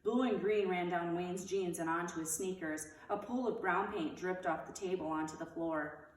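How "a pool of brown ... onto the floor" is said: ordinary delivery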